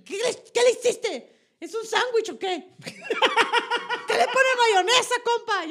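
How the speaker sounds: loud, high, mock-outraged Sonoran northerner; hammed-up outrage in a Sonoran accent, pushing the pitch up. Sonoran newcomer scolding a street vendor about mayo in loud mock outrage. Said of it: "y yo así de tirando".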